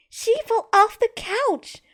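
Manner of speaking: put-on high, slow, upset child voice. A narrator's reading. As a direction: wail it in distress. Girl panicking over sister.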